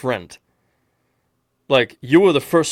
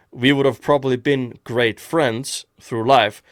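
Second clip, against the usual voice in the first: ordinary talk, nothing marked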